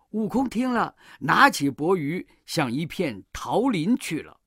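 ordinary delivery

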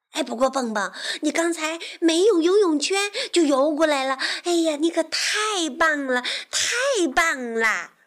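high, loud gushing character voice; excited praise. Acted. Frog amazed his friend swam unaided, excited praise. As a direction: gush with delight.

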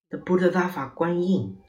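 high-pitched cry of recognition. A narrator's reading. Monk seeing the woman rise, crying out. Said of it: "cried the Tin Monk".

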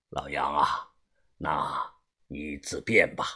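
put-on low, hushed, slow old boss; calm dismissal. A narrator's reading. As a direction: let him go quietly and unhurriedly. (低沉缓慢、平静地说)

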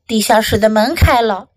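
ordinary delivery, nothing marked

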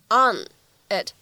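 ON, IT said as two separate words. Shown as a demonstration. Teacher isolating the last two words, word by word. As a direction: clear pause between "on" and "it". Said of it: "末尾的这样的两个单词".

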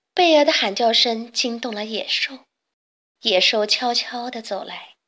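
ordinary delivery

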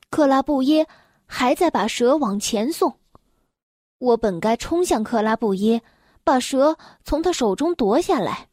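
plain reading voice

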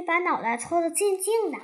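ordinary delivery

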